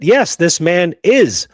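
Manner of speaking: ordinary delivery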